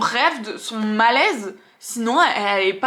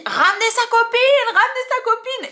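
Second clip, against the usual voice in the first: high-pitched shout, much higher; retold yell; 13 semitones higher, 4 dB louder than usual. Tattooist yelling for the fainted client's friend, high shout. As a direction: yell across the salon, high and urgent. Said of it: "hurle et je dis".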